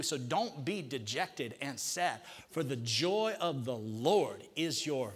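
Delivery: preacher models the verse slowly; slow declaration. Shown as a demonstration. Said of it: "say that in faith".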